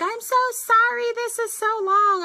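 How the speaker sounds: high, sing-song fake apology; gushing apology. Mimicking the long-message sender who apologizes, in a high mock voice. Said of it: "they're like".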